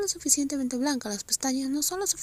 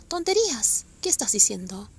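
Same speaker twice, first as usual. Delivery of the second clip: sharp retort; 4 dB louder than usual. Acted. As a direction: snap the word back. Second, sharper dismissal.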